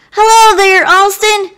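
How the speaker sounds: high, loud friendly call. Acted. Young Abe calling out to his friend Alston across the way. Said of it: "Abe calls out".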